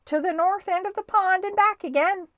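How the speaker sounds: high, loud announcement; referee's shout. A narrator's reading. Referee sets the race course, shouting. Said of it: "shouted the referee".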